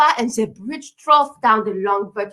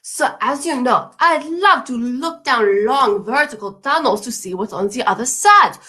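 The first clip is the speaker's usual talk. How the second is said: a lightly put-on French accent, storytelling; hammed-up French accent telling a tale; mock French accent. French traveller tells the boss a tale, in mock French accent. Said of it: "My accent is ambiguous".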